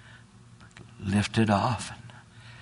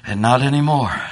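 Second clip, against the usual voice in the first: loud, higher, rising sermon cry; full-force preaching; 5 semitones higher, 7 dB louder than usual. Acted. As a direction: preach it out with fervor.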